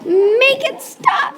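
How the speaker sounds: high, slow panicked yell. Acted. Stepsister panicking at the vacuum, high slow yell. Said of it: "yelled Hidley".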